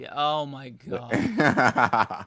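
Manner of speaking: loud slow laugh through words. Real, not acted. Laughing loudly.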